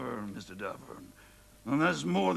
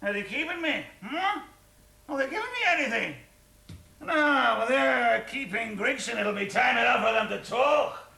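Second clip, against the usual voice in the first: put-on drunken rant; 5 semitones higher than usual. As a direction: rant at the whole house, drunk. Drunk husband ranting at lodgers.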